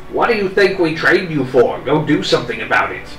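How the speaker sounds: loud, high stern rebuke; stern scolding. Acted. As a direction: scold him hard. Old man scolds sharply.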